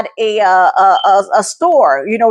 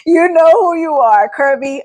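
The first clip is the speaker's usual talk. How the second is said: tearful laugh; 5 semitones higher than usual. Real, not acted. Emotional thanks, laughing and crying.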